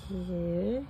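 normal talking voice

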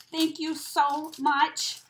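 normal talking voice

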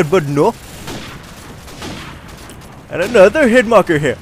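ordinary delivery

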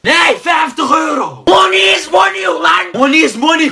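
schreit wütend herum, laut und hoch; angry shout. Acted. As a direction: yell at him, outraged over the money. Furious haggling over change.

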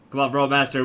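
ordinary talk, nothing marked

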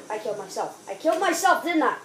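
ordinary talk, nothing marked

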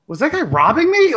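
high-pitched baffled question; mock incredulous squeak; acted belated realization. Drunk friend's belated realization, acted.

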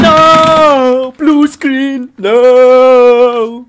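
high, very loud drawn-out wail; despairing scream. Acted. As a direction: scream in horror at the crash. Screams at blue screen.

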